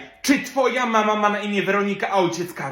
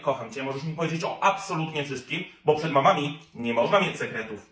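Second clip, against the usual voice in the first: much softer and lower, sweet motherly murmur; tender goodnight; 5 semitones lower, 6 dB quieter than usual. Acted. Mother at the bedside wishing her daughter good night, softly.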